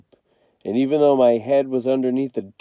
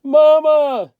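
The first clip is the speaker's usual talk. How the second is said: high, loud desperate cry; hollering for Mama; 8 dB louder than usual. Acted. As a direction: scream for your mother from the water. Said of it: "I hollered out".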